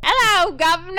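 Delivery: high, bright cockney greeting; chirpy cockney street voice; cockney accent. Acted. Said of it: "she can do voices".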